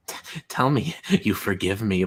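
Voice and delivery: low, slow, creepy mock of Cal; creepy low quote. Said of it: "He's like".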